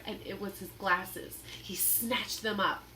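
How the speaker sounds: ordinary delivery, nothing marked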